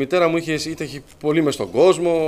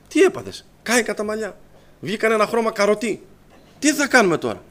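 higher-pitched, louder mock dismay; alarmed retelling; 7 semitones higher, 3 dB louder than usual. Relatives discover mother's ruined hair, alarmed. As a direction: react as if disaster has struck.

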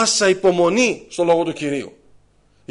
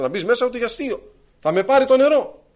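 acted alarm; 8 semitones higher than usual. Woman dreads crossing the torrent.

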